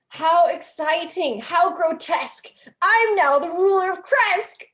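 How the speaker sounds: put-on high, slow, gleeful unhinged boy's scream; creepy fourteen-year-old boy. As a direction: shriek it like a creepy kid crowning himself. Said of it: "a voice for a creepy fourteen-year-old boy".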